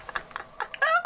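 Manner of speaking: high airy unvoiced giggle; breathy laugh. Real, not acted. Assistant giggling at her boss's outburst, airy laugh. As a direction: let a teasing breathy laugh escape.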